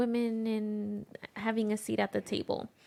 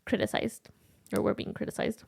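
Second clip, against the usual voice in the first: breathy whisper; 5 dB quieter, faster than usual. Real, not acted. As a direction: drop to a whisper. Unvoiced whispering stretch.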